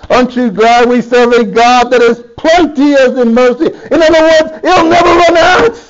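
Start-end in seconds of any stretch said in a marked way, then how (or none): 3.9-5.8 higher, jubilant preacher's call; exultant sermon close, pitched up; rejoicing preaching. Acted. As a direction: lift the congregation in joy.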